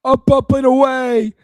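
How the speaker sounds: high, loud, drawn-out commentator call; Dickie V. Acted. Host imitating Zion Williamson calls, high slow shout. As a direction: bellow it slowly like an excited sportscaster. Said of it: "Zion Williamson calls he'd make".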